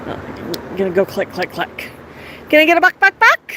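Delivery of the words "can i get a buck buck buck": high, chirpy voice asking the bird to cluck; sing-song coaxing, as if to a pet; coaxing bird talk. Acted.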